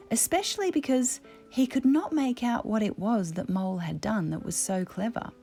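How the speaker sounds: plain reading voice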